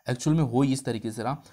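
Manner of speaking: usual speaking voice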